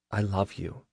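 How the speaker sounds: very soft, vulnerable voice; hushed, tender admission; soft confession. A narrator's reading. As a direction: say it quietly, as if it scares you. Rare, vulnerable love confession.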